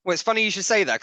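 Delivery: usual speaking voice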